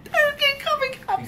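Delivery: very high, drawn-out squeal; playful gloating. Pretend. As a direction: squeal it slowly, sky-high. Gloating over new cups.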